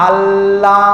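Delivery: আল্লাহ with the double L held briefly; slow, level আল্লাহ, resting on the L; pause on the L. Shown as a demonstration. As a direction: hold the L of আল্লাহ before opening into a long vowel. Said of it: "সেখানে একটা বিরতি নেয়".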